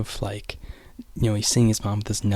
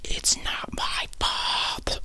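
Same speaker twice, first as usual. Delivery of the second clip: slow, hushed mock yell, softer; held-back yell; 5 dB quieter, much slower than usual. Boy protesting to his mom, yell held back. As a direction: stretch out a retold yell without raising the voice. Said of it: "I'm not gonna yell it".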